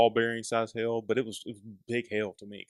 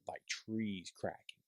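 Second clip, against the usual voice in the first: ordinary delivery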